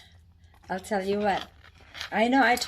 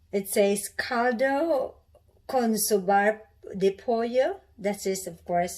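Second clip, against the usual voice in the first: ordinary talk, nothing marked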